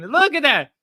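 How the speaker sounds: high, loud outburst; exclaiming. Real, not acted. Streamer spotting something on screen, exclaiming. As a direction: yelp it, pointing everyone to the screen.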